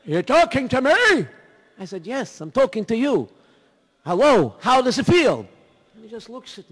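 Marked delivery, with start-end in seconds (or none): 0.0-1.3 loud, high, slow puzzled question; old man shouting with plugged ears; loud confused reply. Retold or imitated. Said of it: "old man with cotton in ears". 2.1-5.5 loud, high shout; shouting at a friend with plugged ears. Retold or imitated. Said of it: "I give a geschrei".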